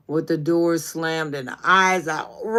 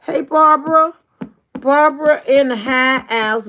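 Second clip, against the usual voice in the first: excited greeting; 6 semitones higher, 6 dB louder than usual. Real, not acted. Hyping up her little sister in the chat, excited call-out. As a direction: announce her like she just walked in.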